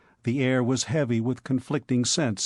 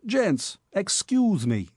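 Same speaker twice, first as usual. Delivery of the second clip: put-on higher New York street accent; a cocky Bowery youth calling in; Bowery boy interrupting the cabmen, New York accent; 6 semitones higher, slower than usual. A narrator's reading. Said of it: "the accent was a familiar accent".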